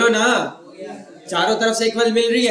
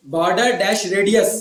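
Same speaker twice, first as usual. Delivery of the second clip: slow dictation; much slower than usual. Shown as a demonstration. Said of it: "dictating syntax slowly".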